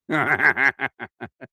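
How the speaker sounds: real fit of chuckles. Real, not acted. Streamer amused by a channel name, giggling. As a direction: crack up at your own joke.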